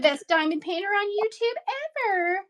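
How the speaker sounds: high, hammed-up bragging; mock boastful voice. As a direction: show off like a cartoon rival.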